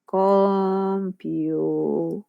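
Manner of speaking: COMPUTER stretched out very slowly; slow-motion "Computer" for the class; drawn-out example word. Shown as a demonstration. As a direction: say "Computer" syllable by syllable, each one held long. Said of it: "por ejemplo".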